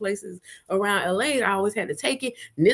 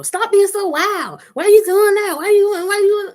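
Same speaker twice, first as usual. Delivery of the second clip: mock nagging voice; nagging complainer; 10 semitones higher, 7 dB louder than usual. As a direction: mimic a whiny scold. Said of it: "all the complaints".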